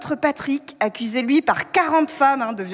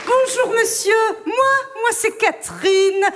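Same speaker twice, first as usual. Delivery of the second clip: tipsy Catherine; 7 semitones higher than usual. Pretend. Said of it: "Je me mets en mode Catherine Fro".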